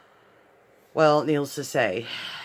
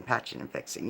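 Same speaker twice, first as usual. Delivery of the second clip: "patching and fixing" whispered, much softer, trailing off; whispered repeat; 4 semitones lower, 10 dB quieter than usual. Real, not acted. Retelling the loose crown, whispering the repeated fix. Repeats phrase, second fades.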